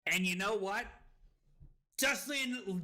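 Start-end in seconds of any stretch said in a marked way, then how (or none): none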